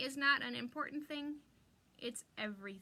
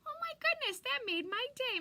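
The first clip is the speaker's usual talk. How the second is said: 1 touched gush; 12 semitones higher, 5 dB louder than usual. Real, not acted. Touched by viewer's kind comment.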